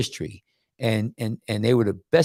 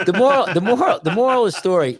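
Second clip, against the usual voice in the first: loud, high, cutting through the laughter; insistent interruption; 9 semitones higher, 6 dB louder, faster than usual. Real, not acted. Host overrides co-host's joke about the story, insistent interruption. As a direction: take the floor back, repeating forcefully. Talks over co-host's joking moral.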